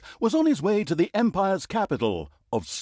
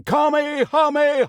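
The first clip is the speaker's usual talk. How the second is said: high, loud battle scream; 13 semitones higher, 7 dB louder than usual. A narrator's reading. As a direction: scream the attack name at full power. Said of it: "while screaming".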